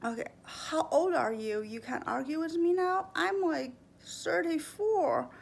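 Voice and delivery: imitated condescending parent rising into a high taunt; sarcastic parent. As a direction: belittle the kid as a scornful parent, slowing at the end. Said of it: "Or when they say".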